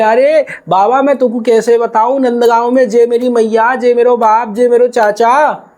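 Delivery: put-on high, desperate boy begging to be let go; a frightened boy trying to get home to his mother; pleading cowherd boy. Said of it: "वो ग्वाल बोले".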